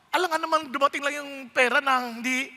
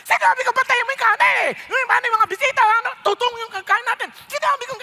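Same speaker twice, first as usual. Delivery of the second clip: higher, louder furious scolding; angry yelling host; 6 semitones higher, 4 dB louder than usual. Retold or imitated. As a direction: yell at the helpers over the burnt rice. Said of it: "galit na galit, sumisigaw". (galit na sigaw ng may-ari ng bahay)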